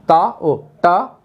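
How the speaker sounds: ता and टा said slowly with little breath; unaspirated stops. Shown as a demonstration. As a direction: say ता, टा slowly, keeping air in. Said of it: "तो ठा में अधिक आई".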